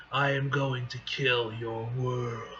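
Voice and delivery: low, slow threat; menacing villain. Acted. Null threatens the heroes' world, menacing. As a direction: deliver it slowly with cold menace.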